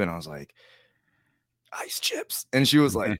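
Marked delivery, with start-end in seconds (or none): 1.7-2.5 breathy, voiceless whisper. Retold or imitated. Patient with a sore throat asks the nurse, whispering. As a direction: barely get the words out with no voice. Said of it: "it was like a whisper".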